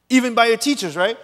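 normal talking voice

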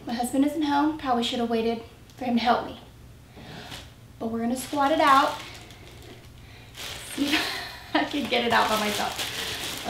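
strained, effortful, under breath; straining. Real, not acted. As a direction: talk while lifting something heavy. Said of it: "Breaking a sweat".

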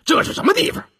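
a servant's sharp, rising scold. A narrator's reading. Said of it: "忙赶过来呵斥道".

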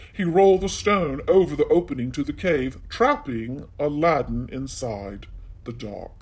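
ordinary delivery

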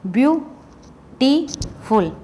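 Beautiful in three slow beats, view - टी - फुल; split by syllables. Shown as a demonstration. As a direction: break beautiful into separate syllables with clear gaps. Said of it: "तो इसमें कितने हो गए".

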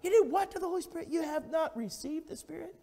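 high, loud challenging preaching; incredulous preaching. Acted. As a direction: confront the sinner with disbelief. Preaching at full force.

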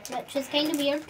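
ordinary delivery

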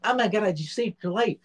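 hammed-up shocked Ron; imitating Ron. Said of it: "Ron leans over my face and goes".

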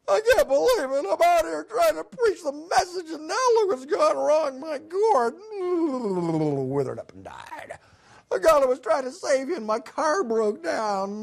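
a prophet wallowing in his bad luck; self-pitying Jonah. Jonah griping about his dead gourd, high whine.

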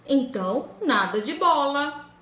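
the frog putting his foot down; stubborn frog. Acted. Frog refuses.

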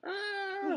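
long, high "aah"; drawn-out cry. Real, not acted. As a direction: let out a sustained high "ah". Reader breaks off with a cry.

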